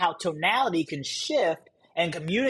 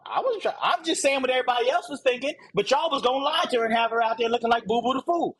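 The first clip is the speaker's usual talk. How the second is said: fast, high, defensive protest; heated self-defence; 6 semitones higher, faster than usual. Retold or imitated. The blunt friend defending himself, fast heated protest. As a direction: rush to justify yourself.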